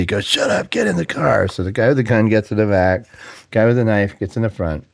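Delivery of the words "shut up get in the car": high-pitched mock angry command; retold irate order, pitched way up; agitated gunman. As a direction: cut him off like an impatient robber. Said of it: "he gets really agitated".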